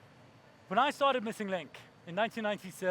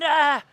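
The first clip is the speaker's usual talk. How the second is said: very loud, higher mock roar; hammed-up attack cry; acted yell; 6 semitones higher, 13 dB louder than usual. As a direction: let out a big theatrical "ah". Acting out the gate attack.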